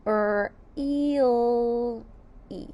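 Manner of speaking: really sounded Er, eel, E; sounding out. Shown as a demonstration. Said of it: "Let's sound out really".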